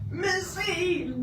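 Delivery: high called-out name; calling the dog by name; hollering a name. Retold or imitated. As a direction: call the dog from the front door.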